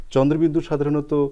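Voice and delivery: usual speaking voice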